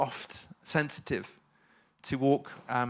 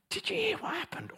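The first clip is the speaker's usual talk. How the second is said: high, hushed gossip voice; gossipy; 6 dB quieter than usual. Acted. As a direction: put on a scandalised, confidential squeak. Acting out gossip in the workplace.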